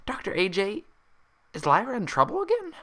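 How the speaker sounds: high bubbly voice gone anxious; a bouncy pony asking nervously; worried Pinkie. Acted. Pinkie asks Applejack about Lyra, worried and high. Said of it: "her shocked expression becoming a worried frown".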